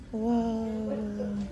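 ordinary talk

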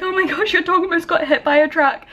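high-pitched, mildly panicked retelling; retold panic. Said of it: "I was, like, panicking".

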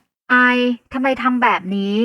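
ต่อว่า; scolding. A narrator's reading. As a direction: scold a friend in disbelief. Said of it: "หวานต่อว่าฉันทันทีที่เจอหน้ากัน".

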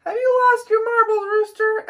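high, loud mocking cat voice; a cat scoffing at the rooster. A narrator's reading. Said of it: "asked Cat".

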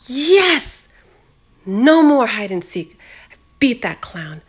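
loud triumphant cheer. Acted. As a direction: cheer your win.

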